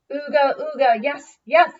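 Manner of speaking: loud, high caveman cheer; the tribe agrees, chanting; cavemen cheering. Acted. Grunts cheer the chief.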